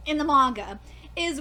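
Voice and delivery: ordinary delivery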